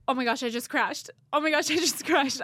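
hammed-up alarm after a crash; imitating panicked friend. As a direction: imitate a frantic phone call, building on the repeat. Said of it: "she'll be screaming".

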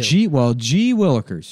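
slow, wholesome gee-whiz voice; mock soda-jerk exclamation; old-timey soda jerk. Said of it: "old-timey, like, a soda jerk guy".

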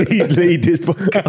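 slow, high laughing plea. Real, not acted. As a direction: beg while laughing. Pleading through laughter.

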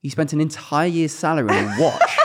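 posh put-down with a laugh in it; haughty posh retort, amused. Retold or imitated. Posh student sneering at the watch, put-on posh. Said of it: "I just got so posh".

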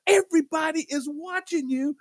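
acting out an embarrassed companion's reproach; retold scolding. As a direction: build into high-pitched accusation. Carla scolds Lamar, retold.

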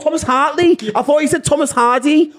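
high, animated Liverpool accent; Scouse impression. Shown as a demonstration. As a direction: exclaim in Scouse, pitched well up. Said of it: "I'm doing a Liverpool accent".

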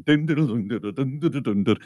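quick muttered jingle imitation; news sounder imitation. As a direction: imitate the news sting running on and on. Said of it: "the news sounder is going".

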